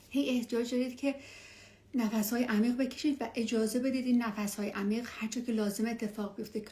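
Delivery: ordinary talk, nothing marked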